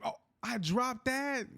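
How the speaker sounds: shocked. Real, not acted. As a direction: disbelief at his own drop. Shocked by dropping the ball.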